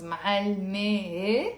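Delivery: معلمة with a second e tacked on, rising; doubled final e. Shown as a demonstration. Teacher demonstrating a mistake, معلمة with a doubled, rising final e. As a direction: stretch an extra e onto the end of معلمة. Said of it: "That's wrong".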